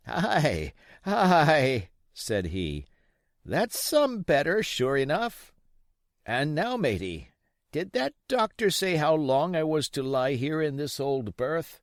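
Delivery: put-on old pirate begging Jim for rum; old sea captain. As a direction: play the sick old buccaneer wheedling the boy.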